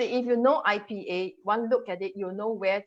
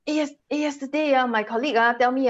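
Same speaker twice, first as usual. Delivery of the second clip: higher-pitched everyday Singlish delivery; 5 semitones higher, 5 dB louder than usual. Shown as a demonstration. Teacher showing how locals tell dad about a colleague, Singlish. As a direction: exaggerate Singaporean English intonation. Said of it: "this is how we speak".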